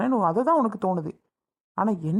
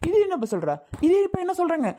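angry outburst; 7 semitones higher than usual. Acted. Husband shouting at his wife in front of her brother, angry. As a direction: voice climbing high, exasperated shouting.

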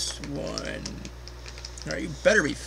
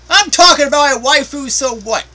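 defiant; 10 semitones higher, 8 dB louder than usual. Real, not acted. Defending her favourite waifu.

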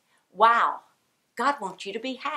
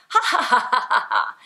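put-on laugh; 3 semitones higher, 6 dB louder than usual. Shown as a demonstration. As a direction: force out a string of laughs. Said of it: "my body doesn't know".

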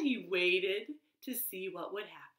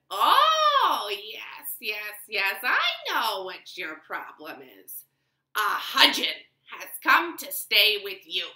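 put-on a wise old woman telling him what's wrong; lightly voiced knowing old woman; 7 dB louder than usual. Wise woman explains to the tailor, knowing and sure. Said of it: "She said".

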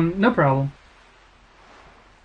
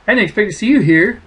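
surprised; 6 semitones higher, 6 dB louder, faster than usual. Acted. Reading surprised game dialogue.

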